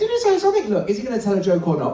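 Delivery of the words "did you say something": high squeaky puppet voice; little dummy voice, pitched way up; Minnie Paul. Acted.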